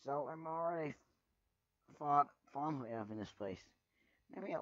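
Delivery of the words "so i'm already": ordinary delivery, nothing marked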